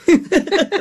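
high-pitched burst of laughter; real amused laugh. Real, not acted. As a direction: laugh at your own punchline. Laughs at own anecdote's punchline.

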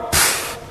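soft scoffing puff of air; dismissive pfft. Acted. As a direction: blow it off with a puff. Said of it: "culture of death today would tell you".

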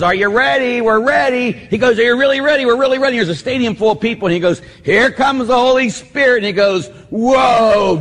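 high, loud hyped-up televangelist; imitating Benny Hinn. Priest mocking a TV faith healer working a stadium, loud and high. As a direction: whip up the stadium crowd. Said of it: "He says".

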